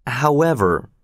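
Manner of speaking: ordinary delivery, nothing marked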